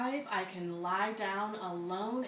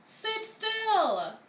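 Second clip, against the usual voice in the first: much higher, loud mock order; high, loud hammed-up command; scolding adult; 14 semitones higher, 5 dB louder than usual. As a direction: bark the order like a fed-up teacher. Parent or teacher command.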